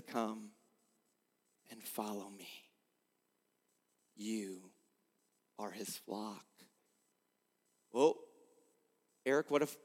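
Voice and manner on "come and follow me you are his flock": hushed, much softer call, lower; God's voice as a hushed invitation; gentle call. Acted. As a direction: call them in softly, almost under the breath. Voicing God's call softly.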